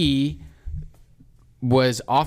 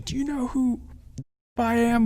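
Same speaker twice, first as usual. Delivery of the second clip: high, hammed-up yelling cop; high mock rant of an off-duty cop; mock screaming cop; 11 semitones higher than usual. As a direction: mimic an angry cop screaming from his car. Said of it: "just starts screaming".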